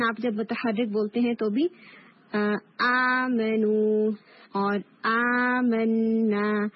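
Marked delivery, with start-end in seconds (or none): 2.8-6.7 آمنو and آمنا slow, the long alif drawn out from the throat; stretched alif recitation. Shown as a demonstration. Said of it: "یہ جو stretch ہو رہی ہے sound".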